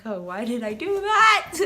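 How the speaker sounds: loud, dramatized regret; self-reproach. Retold or imitated. Recalling her slip with her crush, loud self-reproach. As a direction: cry out at your own blunder.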